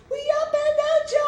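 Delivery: squealed mocking hometown shout. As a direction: yell it like a rowdy kid showing off. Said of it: "being like".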